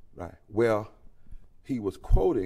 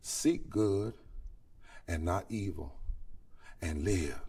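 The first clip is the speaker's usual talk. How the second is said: softer, lower, slow prophetic words; quiet scripture reading; 5 semitones lower, 5 dB quieter, slower than usual. Acted. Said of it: "This is what the prophet says".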